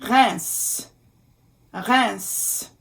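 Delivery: "Reims" said in French as a nasal "rance"-like sound; careful French pronunciation. Shown as a demonstration. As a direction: model "Reims" with a nasal vowel. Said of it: "the name of a city in France".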